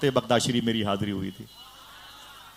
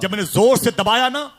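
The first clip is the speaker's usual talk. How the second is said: loud retelling; 9 semitones higher, 5 dB louder than usual. Retold or imitated. Retold killing, loud climax.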